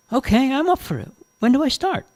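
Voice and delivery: high-pitched, keen acceptance; eager. Acted. Jane accepts the manager's job offer, eager. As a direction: jump at the chance, pitched high.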